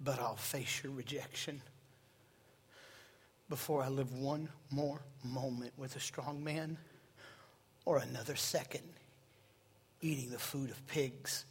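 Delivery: quiet penitent whisper-like voice; hushed prodigal's plea. Acted. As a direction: speak it low and broken, like a son coming home.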